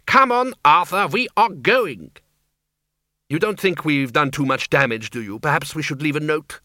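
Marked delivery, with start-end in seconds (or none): 0.1-2.1 put-on high, fussy old lady voice; high voiced lady. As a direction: call out briskly in a high old voice. Character calls to Arthur.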